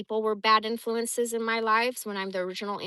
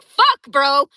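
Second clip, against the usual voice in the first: loud exasperated cry; exasperated outburst; 5 semitones higher, 17 dB louder than usual. Real, not acted. Laughing off her harsh upbringing, loud exasperated cry. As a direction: blurt it out in frustration.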